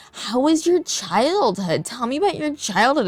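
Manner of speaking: a hint of the nosy boss asking about childhood; mimicking the boss.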